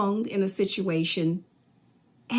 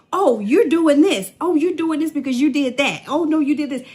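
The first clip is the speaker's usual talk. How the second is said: high, loud insistent accusation; mock accuser, pitched up and insistent; accusing arguer; 7 semitones higher, 6 dB louder than usual. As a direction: hammer the blame on them. Acted argument, accusing voice.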